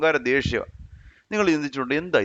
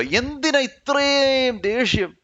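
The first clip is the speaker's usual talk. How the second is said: exasperated why; 10 semitones higher, much slower than usual. Acted. Exasperated question at angry opponents.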